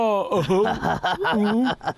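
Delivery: long falling chuckle at him; mocking laugh. Big brother laughing at little brother's dream.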